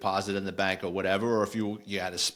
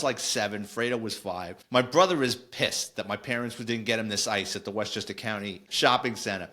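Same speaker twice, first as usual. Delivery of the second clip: usual speaking voice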